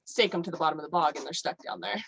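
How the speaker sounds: ordinary talk, nothing marked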